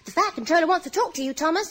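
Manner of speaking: high quick little engine voice; Percy voice. A narrator's reading. Percy warning Thomas, narrated voice.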